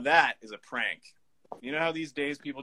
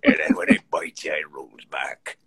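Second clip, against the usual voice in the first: put-on grim old sailor, laughing; 8 semitones lower, 7 dB louder than usual. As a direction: keep the grizzled fisherman voice but crack up. Continuing sailor monologue while laughing.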